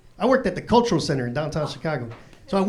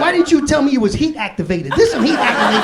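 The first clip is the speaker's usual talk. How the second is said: high, loud accusing protest; mock indignation; 5 semitones higher, 4 dB louder than usual. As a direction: confront the dealer, hammed-up and outraged. Keeps confronting the seller.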